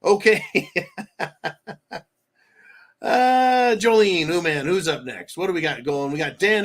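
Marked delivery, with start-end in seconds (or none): 0.5-2.0 laugh. Real, not acted. Laughing after his own joke.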